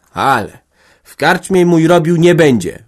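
podniesiony, wykrzyczany głos; okrzyk. A narrator's reading. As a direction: wykrzycz to stanowczo. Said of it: "wykrzyknęła". (głośny okrzyk oburzenia)

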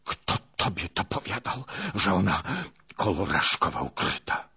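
raspy, strained questioning; hoarse. Acted. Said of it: "pytał chrapliwym głosem". (chrapliwym głosem)

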